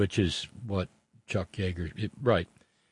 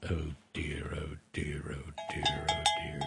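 hushed mock dismay; 6 semitones lower, 10 dB quieter, slower than usual. Mock lament over bad news.

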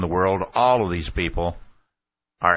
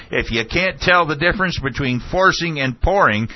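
high, raised imitated tirade; Ashcroft shouting; 8 semitones higher, 4 dB louder than usual. Official haranguing a college audience, imitated shouting. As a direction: retell an indignant shout at high pitch. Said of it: "And Ashcroft shouted".

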